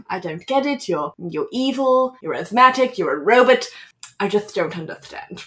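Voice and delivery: mock Darth Vader in a fussy lisped character voice; Hank Azaria impression. Host quoting the pharaoh making fun of Darth Vader, lisping impression. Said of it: "in a lisp. He says".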